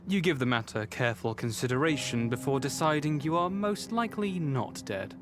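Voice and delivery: usual speaking voice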